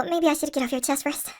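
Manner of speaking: ordinary delivery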